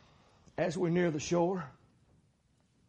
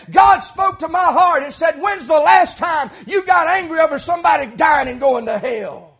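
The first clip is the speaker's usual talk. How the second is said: loud, high-pitched pulpit preaching; fiery preaching; 10 semitones higher, 10 dB louder than usual. Acted. Preacher retelling his apology to David, loud fiery preaching. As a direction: preach it at full force, voice raised.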